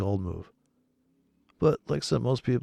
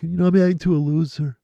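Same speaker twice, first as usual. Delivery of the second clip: higher, louder put-upon trader voice; mock whiny trader; 6 semitones higher, 5 dB louder, faster than usual. Mocking traders who average down, whiny voice. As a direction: whine it like a stubborn loser.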